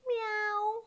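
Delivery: high, soft little meow; shy cat. Acted. Storyteller plays Marmalade for children, shy high meow. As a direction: meow timidly, small and high. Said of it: "A shy cat named Marmalade".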